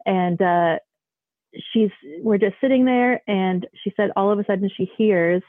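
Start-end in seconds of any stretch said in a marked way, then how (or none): none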